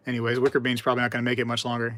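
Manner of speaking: ordinary talk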